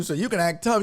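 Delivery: usual speaking voice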